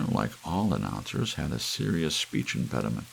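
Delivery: ordinary delivery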